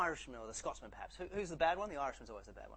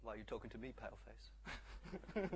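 low, soft, unbothered reply; deadpan retort; 4 semitones lower, 8 dB quieter than usual. Acted. Companion coolly disowns the panicking cowboy, deadpan. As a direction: drop the punchline quietly and coolly. Tonto's deadpan punchline after shouting.

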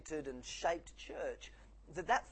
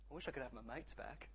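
lonely; 8 dB quieter than usual. Acted. Lonely Irishman's wish, joke punchline.

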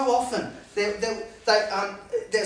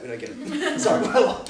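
laughing confession; 7 semitones higher, 7 dB louder than usual. Retold or imitated. Disciples' sheepish confession.

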